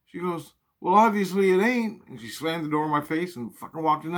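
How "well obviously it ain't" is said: high, loud retort from mom; mom's snappy comeback. Retold or imitated. Mom snapping back before slamming the door. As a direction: shut him down and slam the door.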